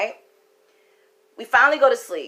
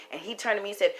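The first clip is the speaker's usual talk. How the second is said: ordinary talk, nothing marked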